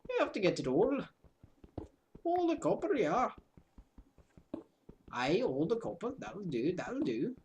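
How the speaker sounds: playful half-Welsh voice; mock Welsh accent. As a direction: keep the silly Welsh accent going. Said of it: "something close to Welsh".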